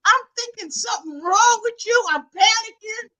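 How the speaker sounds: high, incredulous comic rant; exasperated disbelief. Retold or imitated. Grandchild confronting grandma after panicking, mock indignation. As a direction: re-enact an indignant telling-off. Acted scolding of grandmother's false alarm.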